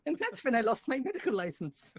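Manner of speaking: put-on high, loud comic German accent; hammed-up German accent, laughing at it; silly high-pitched German confession. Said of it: "When I do my German ac".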